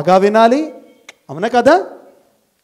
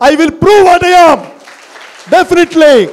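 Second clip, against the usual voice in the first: much higher, much louder and slow; defiant vow; 10 semitones higher, 9 dB louder, much slower than usual. Acted. Blind boy vowing to his weeping mother, defiant shout. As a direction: hurl the promise out, defiant.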